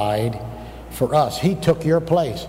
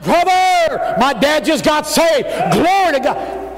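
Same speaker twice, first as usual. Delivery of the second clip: high, elated holler; exultant outburst; 10 semitones higher than usual. Retold or imitated. Student telling the doctor his dad got saved, exultant. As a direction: cry it out, bursting with good news.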